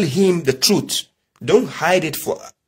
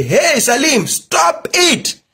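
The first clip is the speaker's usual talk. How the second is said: high-pitched yell to stop him; retold shout; 12 semitones higher, much slower than usual. Father yelling at his noisy son, retold high shout. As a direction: call out sharply in a raised, high voice. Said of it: "I have to shout and say".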